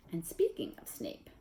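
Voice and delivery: unvoiced, secretive aside; whisper. A narrator's reading. Narrator hushing listeners as Snape appears, whispered. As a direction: breathe it as a hushed warning. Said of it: "unvoiced whisper".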